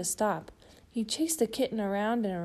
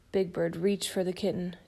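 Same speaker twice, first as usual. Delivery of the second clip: ordinary delivery, nothing marked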